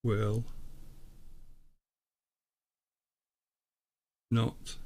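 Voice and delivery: each word spoken alone after a long pause; solemn reading, one word at a time, as if receiving it; measured, deliberate dictation with long gaps between words; word-by-word channelled message. Acted. Reads pendulum message word by word.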